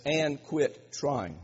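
drawn out "and quit trying", every word weighted; slow the verse right down on "quit trying"; slow stress on and quit trying. Shown as a demonstration. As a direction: lean hard on the ending. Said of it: "Now look at this".

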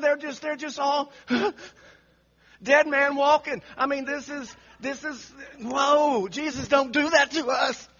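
ordinary talk

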